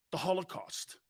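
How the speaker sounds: normal talking voice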